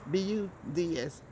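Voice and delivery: name each letter of B U D S separately with small gaps; B U D S spelled letter by letter so it isn't heard as bugs. Shown as a demonstration. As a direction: spell out B U D S one letter at a time. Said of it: "not bugs".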